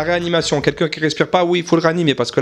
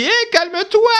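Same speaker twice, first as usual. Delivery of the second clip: high-pitched mock scolding; shrieky imitation of a wife; wife impression; 13 semitones higher than usual. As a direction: call it out high, rising at the end. Imitates wife telling him to calm down.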